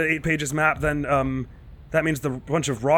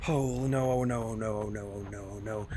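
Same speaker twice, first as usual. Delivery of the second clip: softer and softer frightened oh no; hushed panicked mutter; 4 semitones lower, 10 dB quieter than usual. Real, not acted. Player sprinting from Slender, fading to a hushed mutter. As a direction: barely voice the words as fear sinks in.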